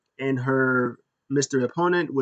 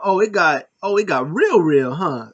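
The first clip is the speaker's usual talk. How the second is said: higher, louder hyped reaction; excited; 5 semitones higher, 6 dB louder than usual. Retold or imitated. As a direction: yell it like the match just blew up. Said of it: "I was like".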